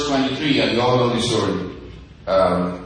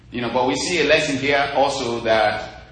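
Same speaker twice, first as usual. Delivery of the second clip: ordinary talk, nothing marked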